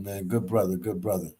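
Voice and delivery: ordinary talk, nothing marked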